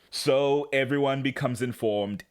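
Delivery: normal talking voice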